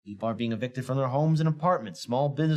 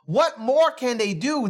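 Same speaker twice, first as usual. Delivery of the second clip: louder, slower, exasperated question; 10 semitones higher, 6 dB louder, slower than usual. Real, not acted. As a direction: throw up your hands, slowly. Exasperated rant on old lawmakers.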